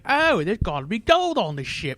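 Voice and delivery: exaggerated celebrity impression, stretched vowels; Michael Caine. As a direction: drawl it like a hammed-up Cockney actor. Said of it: "That's a pretty good Michael Caine impression".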